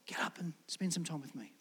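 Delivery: breathy whisper, soft and intimate; whispered invitation. Pretend. God whispering to Murray to spend time with him, whispered. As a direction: whisper it like a secret call.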